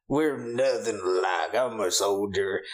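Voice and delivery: slow, lightly drawled soldier parody; mock soldier voice. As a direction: drawl it like a stolid movie soldier. Imitating Rick Flag the soldier.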